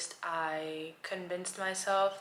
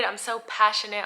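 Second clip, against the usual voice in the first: excited; 3 semitones higher, 8 dB louder than usual. Real, not acted. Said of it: "I'm so excited".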